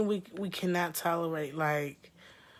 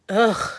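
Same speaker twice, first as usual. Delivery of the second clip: disgusted ugh; 4 semitones higher, 9 dB louder than usual. Real, not acted.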